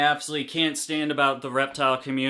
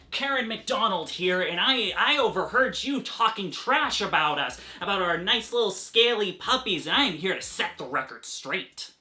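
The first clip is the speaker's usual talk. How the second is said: doting owner skit; 2 semitones higher than usual. Acted. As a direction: coo like a doting pet parent.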